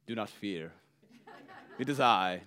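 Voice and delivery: low, soft attempt at an Italian accent; bad Italian accent. Jesus walking out to the disciples, half-hearted Italian accent. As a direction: try an Italian accent you can't quite do. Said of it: "in an Italian accent that I can't do".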